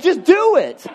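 high, pushed just do it; urging push. Retold or imitated. Urging himself, high and loud.

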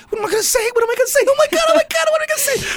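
very high, frantic, rising squeal; panicked fan squeal. Retold or imitated. Panicking before meeting heroes.

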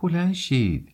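high-pitched cry, lightly flustered; anxious but amused. A narrator's reading. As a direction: call out half worried, half entertained. (مضطرب و در عین حال سرگرم)

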